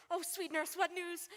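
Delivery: ordinary delivery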